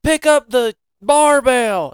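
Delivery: coach's order. Retold or imitated. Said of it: "Chris was like".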